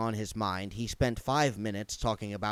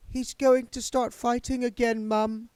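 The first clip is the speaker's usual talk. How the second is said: put-on higher, resigned girl's voice; a lightly voiced daughter sighing to her mother; weary daughter; 13 semitones higher, 5 dB louder than usual. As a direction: daughter warning her mother about the ghost.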